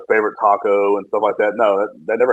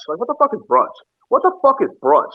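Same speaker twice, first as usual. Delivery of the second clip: baffled outrage; 11 semitones higher than usual. Real, not acted. Exasperated rant about brunch.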